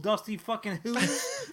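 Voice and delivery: ordinary delivery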